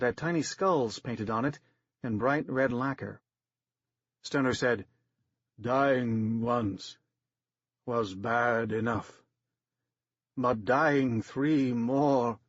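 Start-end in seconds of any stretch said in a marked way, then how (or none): 5.6-12.4 slow, dragging dying actor's voice; deep actor's groan. A narrator's reading. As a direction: drag each word out, dying. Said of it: "groaning in his deep actor's voice".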